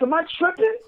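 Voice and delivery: high, baffled self-questioning; mock disbelief. Voicing Finn's bewildered reaction.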